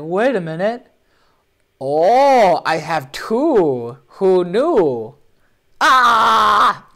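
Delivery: high, loud, drawn-out mock astonishment; hammed-up mock surprise. Host voicing a swordsman revealing a second weapon, hammed-up mock surprise. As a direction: draw out the fake amazement into a loud cry.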